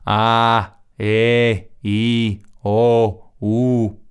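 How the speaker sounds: each vowel "A", "E", "I", "O", "U" held long and even; sustained vowels. Shown as a demonstration. Teacher showing students that vowels have no attack. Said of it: "las vocales no tienen transitorio".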